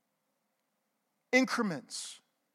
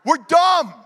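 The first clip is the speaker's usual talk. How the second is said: loud, higher-pitched declaration; 5 semitones higher, 10 dB louder than usual. Shown as a demonstration. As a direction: call it out to the whole room. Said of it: "say it loud".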